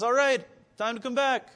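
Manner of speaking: lightly acted shepherd's call; calling back. Jesus voiced calling the sheep back.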